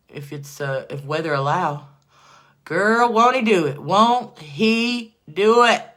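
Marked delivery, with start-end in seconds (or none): 2.6-5.8 loud, drawn-out joyful praise. Real, not acted. Getting loud and emotional about Jesus, loud praise. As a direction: stretch out the praise loudly. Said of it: "sorry if I'm getting loud".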